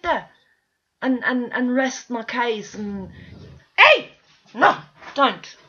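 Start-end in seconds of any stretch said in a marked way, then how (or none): 3.8-5.6 sharp call at the dogs. Real, not acted. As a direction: stop the dogs fighting, fast and sharp. Scolding dogs fighting.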